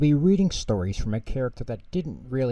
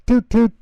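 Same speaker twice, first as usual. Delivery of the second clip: scooter horn toot; 14 semitones higher, 10 dB louder than usual. Acted. Narrator imitates scooter horn.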